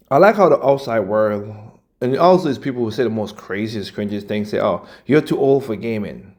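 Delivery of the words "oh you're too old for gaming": normal talking voice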